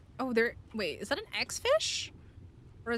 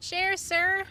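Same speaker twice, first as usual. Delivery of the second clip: mock demand; 7 semitones higher, 6 dB louder than usual. Demanding the pet share.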